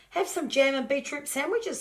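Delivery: high Pauline voice. Acted.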